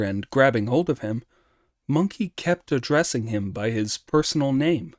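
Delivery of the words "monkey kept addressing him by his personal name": plain reading voice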